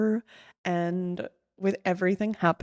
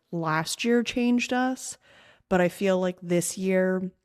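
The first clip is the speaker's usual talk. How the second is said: ordinary talk, nothing marked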